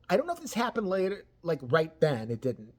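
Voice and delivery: usual speaking voice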